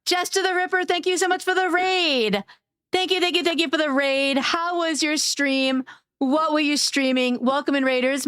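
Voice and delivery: bright, high welcome for raiders; chirpy hostess greeting the raid; cute anime streamer. Acted.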